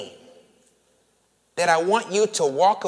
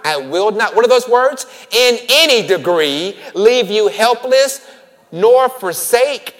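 louder, higher fervent sermon cadence; preaching at full force; 6 semitones higher, 10 dB louder than usual. Acted. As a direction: drive the promise home to the congregation. Preaching climax over scripture.

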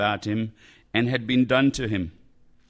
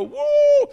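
triumphant whoop; 8 dB louder than usual. Pretend. Caesars' triumph, mock celebration.

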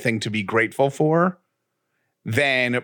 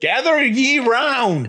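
town crier; 11 semitones higher, slower than usual. Pretend. Mock proclamation on tree stump.

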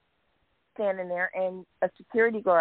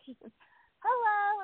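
imitated unsure hello; 13 semitones higher than usual. She greets a wife she can't name, imitated unsure high hello. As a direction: return a high, uncertain hello. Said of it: "I'm thinking, What do I call her".

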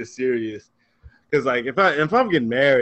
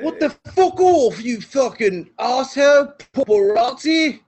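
high, slow shouted cursing; swearing tirade; 11 semitones higher, 6 dB louder, slower than usual. Acted. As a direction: yell curses at the paparazzi. Outburst at the paparazzi photo.

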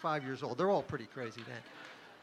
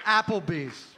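mock shout; 5 dB louder, slower than usual. Punchline shouted.

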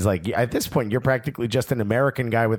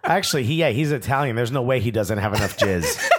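ordinary delivery

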